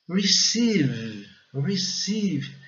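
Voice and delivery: "Receive" with stressed, long ee in second syllable; English pronunciation demo. Shown as a demonstration. Teacher models the word for learners, careful pronunciation. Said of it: "ele caracteriza a sílaba forte".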